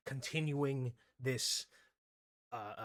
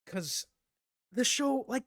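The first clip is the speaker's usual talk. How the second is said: usual speaking voice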